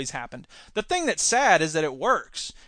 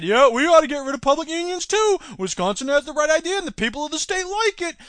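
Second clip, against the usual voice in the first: higher, smug governor's voice; mock the governor, pitched up high; 8 semitones higher, 3 dB louder than usual. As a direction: ham up a self-satisfied politician. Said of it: "Indiana said".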